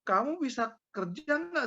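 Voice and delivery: high-pitched, indignant challenge; retold angry outburst. Angry husband confronting the bank teller, indignant. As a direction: confront them, re-enacting your temper. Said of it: "wah saya emosi gitu loh".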